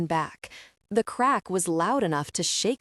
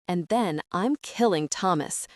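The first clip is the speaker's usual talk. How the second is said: plain reading voice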